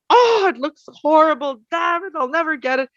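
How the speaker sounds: high, frustrated groan at the bannock; mock despair over a failed batch; exasperated, imitating her past self. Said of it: "and I'm like".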